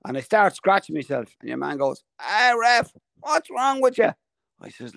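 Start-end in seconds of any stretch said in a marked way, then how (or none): 2.2-4.1 mock heckler. Heckler voiced in story.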